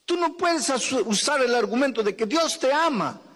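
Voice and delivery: high, urgent preaching to the congregation; full-force preaching. Acted. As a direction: preach it out, pleading and rising.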